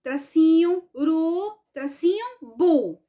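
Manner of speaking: "U", "ru", "bu" each set apart; separated syllables. Shown as a demonstration. Teacher showing pupils how to separate, syllables one at a time. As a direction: spell it out with "tracinho" between syllables. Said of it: "Aí você vai separar".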